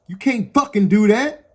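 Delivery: imitating the friend screaming at officers; friend's voice. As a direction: mimic him yelling at the cops. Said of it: "in his voice".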